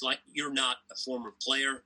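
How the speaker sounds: lightly imitated American accent; Jim Courier's American accent. Imitating American co-commentator's question, light American accent. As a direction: toss it off in a hint of an American accent. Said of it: "I'll try and do my best impersonation".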